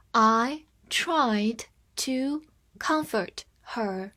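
very slow, one word at a time; slow reading. Shown as a demonstration. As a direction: pause after every word for learners. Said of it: "放慢速度来一遍". (一字一顿地慢速朗读)

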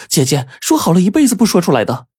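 put-on high, urgent girlish protest; anxious cry. A narrator's reading. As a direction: cry out in panic to big sister. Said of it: "婴儿急得都喊了起来".

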